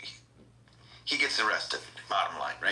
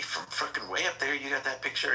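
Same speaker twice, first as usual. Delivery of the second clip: higher-pitched, mildly incredulous question; mock amazement, pitched up; retold disbelief; 7 semitones higher than usual. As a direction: act out someone who can't believe it. Retold suspect doubts the drone photo.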